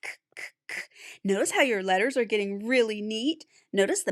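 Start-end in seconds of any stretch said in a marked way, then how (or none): 0.0-0.8 K, k, k as soft voiceless puffs; letter sound k. Shown as a demonstration. Teacher models the climbing cat's sound for kids, voiceless k puffs. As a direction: breathe out short k sounds with no voice. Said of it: "and make the sound".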